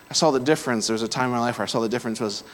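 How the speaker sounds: ordinary talk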